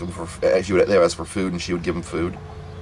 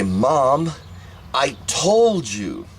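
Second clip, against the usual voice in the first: raised, drawn-out I-told-you-so; exasperated reproach; 5 semitones higher, much slower than usual. Retold or imitated. Child who warned mom all along, imitated exasperated reproach. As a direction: imitate a kid reproaching mom, stretching the words out high.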